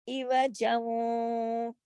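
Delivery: इवजं slow with ऐन from the throat; chanted इवजं; drawn recitation. Shown as a demonstration. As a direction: stretch इवजं slowly. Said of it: "ऐन को इवजं".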